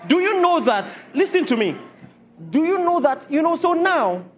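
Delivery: high, loud hammering question; preacher demanding attention; fiery preaching. Acted. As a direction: press the point hard on the congregation. Preaching at full force.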